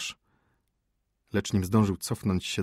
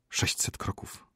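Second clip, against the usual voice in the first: soft, hushed whisper; 5 dB quieter than usual. A narrator's reading. Doctor telling the dying prince, whisper. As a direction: breathe the answer out almost without voice. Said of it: "szepnął lekarz".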